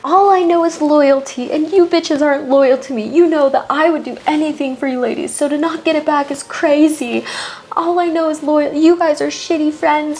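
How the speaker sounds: hammed-up tearful complaint; mock crying Jen. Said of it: "Jen starts crying".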